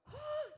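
sharp, high gasp of horror; startled gasp. Retold or imitated. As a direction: gasp as if a spider is on you. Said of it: "This is what I did".

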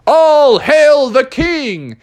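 high, slow grand proclaiming; drawn-out grand call; Bubby proclaiming himself king; royal proclamation. Acted. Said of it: "Bubby was like".

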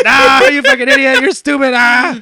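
loud, high-pitched taunting yell; mock jeering. Troll taunting a woman on livestream, mock jeering. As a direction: imitate a gleeful heckler shrieking insults.

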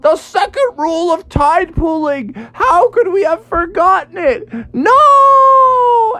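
high lament building to a wail; despairing cry. Acted. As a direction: cry out in mock anguish, ending on a long high scream. Toadstool despairs, dragged out to sea.

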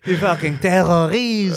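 hammed-up angry Quebec yell; mock Quebec accent. Doing the best Quebec accent for his friend, mock angry. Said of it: "It was the best Quebec accent".

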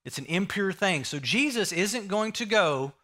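usual speaking voice

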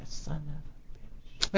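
drawn-out whisper of mock disbelief; whispered curse. Aside on rival's success.

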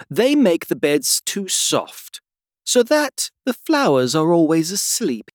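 high, slow flower character; know-it-all flower explaining; lecturing Tiger Lily. A narrator's reading.

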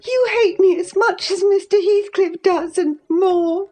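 high, tearful accusation; upset girl's voice held very high; openly distressed. A narrator's reading. Mistress accusing her cousin, openly distressed and high. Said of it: "no longer disguising her trouble".

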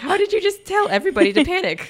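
high, loud disbelief; exasperated outburst. Real, not acted. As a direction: shout in incredulous outrage. Exasperated at Stonekeeper.

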